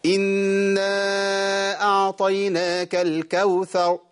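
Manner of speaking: high, slow chant with no ر ending الكوثر; singsong recitation, الكوثر fading before ر; lost final ra. Shown as a demonstration. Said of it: "ما جبتش راء".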